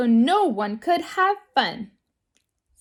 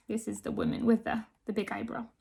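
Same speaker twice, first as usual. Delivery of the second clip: much softer remark to the listeners; quiet aside; 2 semitones lower, 8 dB quieter, much faster than usual. Real, not acted. Narrator quietly points out the eyebrow woman to the children. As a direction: drop out of the story and confide softly.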